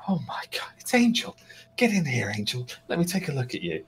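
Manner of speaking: lightly voiced delighted orc wife, hushed. Game master voicing excited orc wife.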